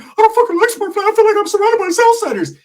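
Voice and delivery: very high, loud cartoonish complaint; Ricky. Cousin complaining in Smurfland, loud squeaky put-on voice. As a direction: whine it in an exaggerated squeaky impression. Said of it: "Ricky's like".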